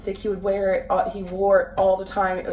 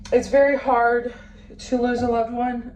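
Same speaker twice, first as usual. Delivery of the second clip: higher, strained with held-back crying; real near tears; 5 semitones higher than usual. Real, not acted. Vlogger tells viewers about losing a loved one, near tears. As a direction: push through it while close to crying. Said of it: "Now I wanna cry".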